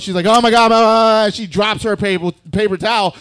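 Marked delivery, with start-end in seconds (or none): 0.3-1.3 high, loud hammed-up freak-out; shrill-pitched mock hysteria; mock panic attack. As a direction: imitate a panicking roommate babbling loudly. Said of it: "she just starts having a panic attack".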